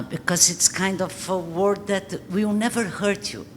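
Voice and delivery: normal talking voice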